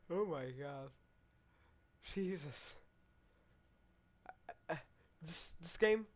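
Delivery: usual speaking voice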